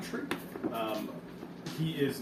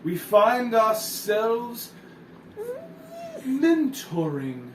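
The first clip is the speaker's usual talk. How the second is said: pompous narrator voice; 7 semitones higher, 4 dB louder than usual. Acted. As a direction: announce it like a self-important chronicler. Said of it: "He says".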